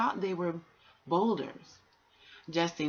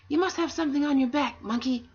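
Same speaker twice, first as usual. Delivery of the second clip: much higher and louder, a small creature calling out; high tortoise voice; 10 semitones higher, 7 dB louder, faster than usual. Acted. Tortoise asking the monkey in the dark, high and loud. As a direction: pipe it up high like a hidden tortoise.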